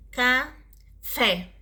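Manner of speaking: slow "café", pause, then a strong "fé"; stress on last syllable. Shown as a demonstration. Teacher giving an oxytone example, stress on last syllable. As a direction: split "café" and land on "fé". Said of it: "última sílaba mais forte".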